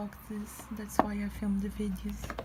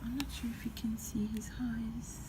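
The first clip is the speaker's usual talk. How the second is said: hushed excited whisper; breathy whispered awe over the toy; 3 dB quieter than usual. Real, not acted. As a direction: whisper it like a secret to the viewers. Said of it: "I don't know why I'm doing this voice".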